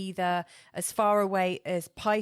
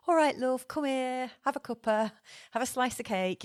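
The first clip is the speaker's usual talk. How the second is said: put-on a homely, motherly north of England accent, a bit higher; northern English accent; 5 semitones higher than usual. Motherly Venus in Cancer offering a cuppa, in a homely northern English accent. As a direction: offer tea and cake like a cosy northern English mum. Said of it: "heading towards the north of England".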